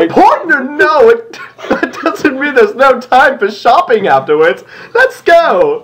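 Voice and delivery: giggling through a high gushing pony voice; eager Rarity. Acted. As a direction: sell the shopping trip, bubbling over. Reading Rarity's eager line.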